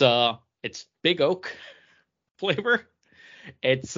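ordinary delivery

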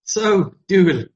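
patient sitcom Irish accent; comic Irish, drawn out at the end. Shown as a demonstration. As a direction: explain it slowly in an Irish voice, nearly laughing. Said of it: "the best Irish accent".

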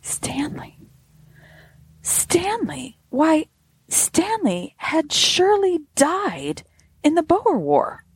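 hushed first word rising into high, stunned disbelief; dawning amazement. A narrator's reading. Crash victim realising his friend died long ago, high amazement. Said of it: "a wave of amazement passed over me".

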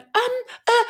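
lost for words, caught off guard; flustered. Retold or imitated. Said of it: "I was so flustered".

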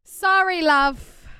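loud pitying cry; sympathy belted out; loud sympathetic. Real, not acted.

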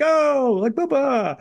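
high, hammed-up parody of a swaggering Italian guy; mock macho Italian. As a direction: toss off a cartoon Italian bravado voice. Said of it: "play exaggerated, almost parodied versions".

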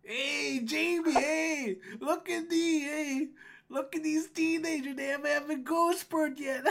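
high, teasing gnome guard calling a friend over; gatekeeper gnome, mocking. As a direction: jeer at the kids, ending in a laugh.

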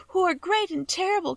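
put-on a small girl echoing "great and terrible" in a high plea; tearful child repeating "great and terrible"; little girl Dorothy. A narrator's reading. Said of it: "she sobbed".

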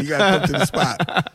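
usual speaking voice

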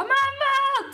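high, loud call for mum; mock panicked cry. Acts out panicked call to mother.

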